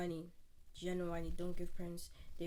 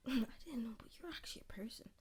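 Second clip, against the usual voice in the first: whispered, much softer reveal; hushed; 2 semitones higher, 8 dB quieter than usual. Retold or imitated. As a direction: confide it to mom in a whisper. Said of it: "go to your mom and be like".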